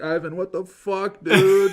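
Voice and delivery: high, loud tearful outburst; crying out to his cat. Retold or imitated. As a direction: wail at the cat through tears. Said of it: "I was like".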